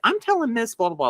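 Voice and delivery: put-on high-pitched little girl threat; imitated tattling child. Little girl threatens to tell teacher, high mock tattle.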